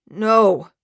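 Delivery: loud, sickened denial; sounding sick. Acted. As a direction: refuse it, sick with dread. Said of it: "sounding sick".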